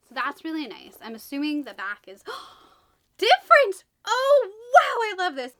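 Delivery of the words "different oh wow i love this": high, loud burst of delight; excited gasp. Real, not acted. Booktuber discovering the different back cover, excited gush. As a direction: gush like you just found treasure.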